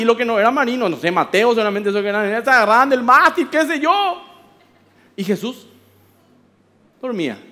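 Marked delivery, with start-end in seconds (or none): none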